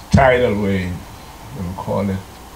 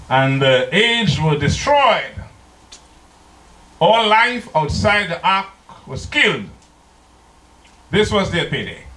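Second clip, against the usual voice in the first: higher, slow, building sermon voice; preaching; 7 semitones higher than usual. Acted. Preacher tells the congregation of the flood, rising and slow. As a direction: preach it, lifting the pitch.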